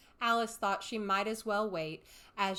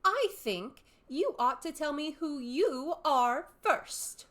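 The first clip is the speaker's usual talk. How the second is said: put-on slow, louder, grave girl; very gravely; 7 semitones higher, 7 dB louder, slower than usual. A narrator's reading. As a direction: draw yourself up and say it slowly. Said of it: "said very gravely".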